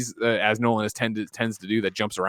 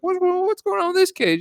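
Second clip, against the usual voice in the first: much higher, confused put-on voice; mock puzzled character. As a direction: play him baffled and high. Voicing Bale's character in recap.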